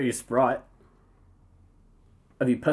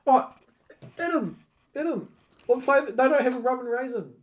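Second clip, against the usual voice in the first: high fussy voice; 9 semitones higher than usual. Pretend. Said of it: "I'm gonna go".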